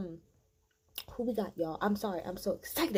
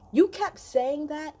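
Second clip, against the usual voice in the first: angry; 6 semitones higher, 6 dB louder, slower than usual. Real, not acted. Angry rant at Kiowa.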